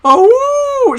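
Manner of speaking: imitated high, loud wolf howl; long mock howl. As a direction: throw your head back and howl, mockingly. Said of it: "Howling noises to the whole classroom".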